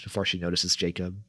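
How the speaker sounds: plain reading voice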